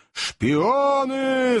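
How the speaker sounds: high, drawn-out accusing roar; crowd roar. A narrator's reading. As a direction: bellow it long as the mob would. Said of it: "снова заревела толпа". (протяжный рёв толпы)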